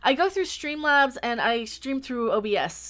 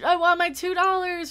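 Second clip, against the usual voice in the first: lightly imitated movie line, higher pitched; movie quote; 7 semitones higher, 5 dB louder than usual. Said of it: "from Better Off Dead".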